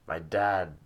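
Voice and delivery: ordinary delivery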